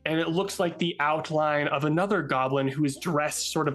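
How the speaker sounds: ordinary delivery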